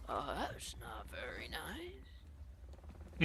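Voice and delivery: put-on old horse whispering, wounded. Old horse whispers at the insult. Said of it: "the old horse just goes".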